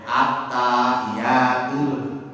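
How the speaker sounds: "At-tahiyatul" said slowly with vowels held long; wrong stretched reading. Shown as a demonstration. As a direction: drag out "At-tahiyatul" syllable by syllable. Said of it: "Nah, itu salah bacanya".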